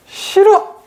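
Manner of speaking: high-pitched, immediate no; instinctive refusal. Acted. Ego hearing of a challenge, instinctive refusal. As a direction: blurt it before thinking. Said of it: "이렇게 먼저 나온단 말이에요".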